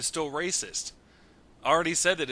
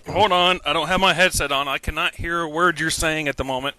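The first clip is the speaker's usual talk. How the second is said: loud without headset; 2 semitones higher, 7 dB louder than usual. Real, not acted. Headset off, can't hear.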